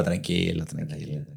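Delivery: soft, low soothing voice; mom calming. Retold or imitated. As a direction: hush him like a calm mother. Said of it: "mi mamá sí me dijo".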